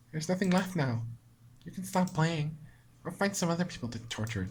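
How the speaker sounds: soft, resigned; lightly voiced bitter surrender; defeated Monika. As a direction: let the fight drain out.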